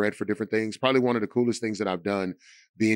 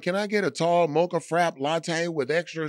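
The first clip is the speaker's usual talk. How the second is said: lightly voiced, higher-pitched coffee orderer; mock coffee customer; 7 semitones higher than usual. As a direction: imitate a fussy sweet-drink order, pitched up. Mocking sugary coffee orderers.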